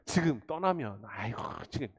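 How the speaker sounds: a hint of a reluctant mother begging; pleading family. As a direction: coax the servant to linger a few days. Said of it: "이렇게 얘기합니다".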